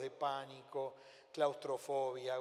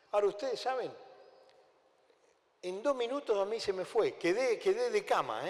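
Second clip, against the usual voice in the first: emphatic preaching; 5 semitones higher, 5 dB louder than usual. Real, not acted. Preacher builds to emphatic point.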